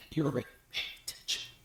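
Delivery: barely audible whisper; hushed whisper. Shown as a demonstration. Speaker drawing the audience's attention by volume, whispering. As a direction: drop to a tiny whisper so they lean in.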